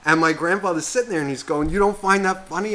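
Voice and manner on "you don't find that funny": higher-pitched retold challenge; mock incredulous grandfather. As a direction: ask it as an imitated, disbelieving grandpa. Acts grandfather questioning him.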